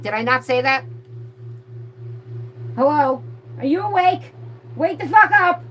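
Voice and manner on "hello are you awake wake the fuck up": raised, high call rising to a yell; wake-up shout. Pretend. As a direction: yell to rouse a dozing audience. Jolting inattentive viewers awake.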